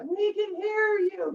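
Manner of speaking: high slow called answer from the shore; crowd calling back. Retold or imitated. Townspeople answering Aesop across the lake, high slow call. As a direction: call back slowly and brightly. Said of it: "They're like".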